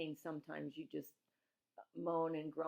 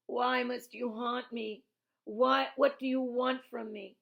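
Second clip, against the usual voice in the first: moaning delirious granny; 7 semitones higher, 9 dB louder, slower than usual. A narrator's reading. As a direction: plead like a feverish grandmother haunted by ghosts. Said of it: "Granny moaned".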